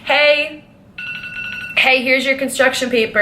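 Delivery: high-pitched, breezy imitation of a coworker; mock casual return. Teacher handing back borrowed supplies, mock casual. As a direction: act out her blithe "hey", pitched well up.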